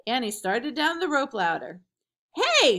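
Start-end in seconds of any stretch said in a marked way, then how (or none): 2.4-2.8 high, loud shout; alarmed high shout. A narrator's reading. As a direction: yell after her. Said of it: "shouted Jack".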